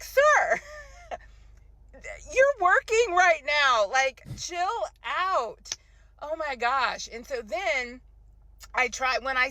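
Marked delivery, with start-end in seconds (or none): 0.0-5.7 exasperated scolding. Retold or imitated. Telling off a rude customer.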